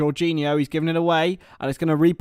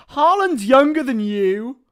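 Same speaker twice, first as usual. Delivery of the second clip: slow, high, drawn-out disbelief; stunned question at the news; shocked disbelief; 8 semitones higher, 5 dB louder, slower than usual. Real, not acted. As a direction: stretch it out in disbelief. Shocked at sister's age.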